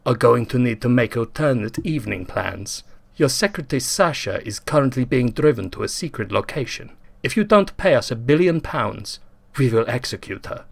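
put-on menacing villain in a mild Russian accent; heavily accented. Russian kidnapper on the intercom, in a mild Russian accent. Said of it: "said a heavily accented voice".

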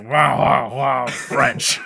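a hedge gasping in mock offense; voiced offended hedge. Hedge reacting to being called French, mock offense. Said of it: "the hedge is just like".

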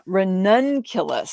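ranunculus said slowly, stress on nun; careful pronunciation. Shown as a demonstration. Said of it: "but it is pronounced".

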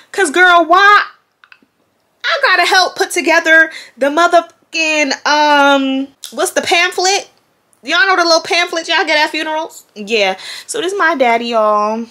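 high, loud and animated. Real, not acted. To her viewers, explaining the funeral pamphlet, high and keyed up. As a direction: rush through it all keyed up and high.

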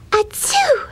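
tiny, very high-pitched mock sneeze; kitten sneeze. A narrator's reading. Custard the kitten interrupts Blueberry, squeaky pretend sneeze. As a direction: squeak out a little acted "achoo". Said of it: "sneezed Custard again".

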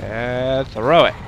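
very slow, stretched build-up to the hit; drawn-out move call. Pretend. Gamer timing a throw and finish, drawn-out call. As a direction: stretch the first word until the move lands. Calling own moves in game.

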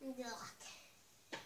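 "Yuck" breathed out as a soft whisper. Pretend. Tasting pretend soup.

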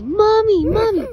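high, loud fake wail for mommy; fake crying. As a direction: cry out for mommy, loudly faked. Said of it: "Let me hear you fake cry".

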